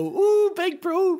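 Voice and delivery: high, slow drawn-out ooh; mock catchphrase. Answering a mate with the meme, high and slow. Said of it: "I go".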